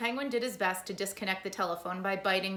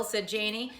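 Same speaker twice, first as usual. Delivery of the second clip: ordinary delivery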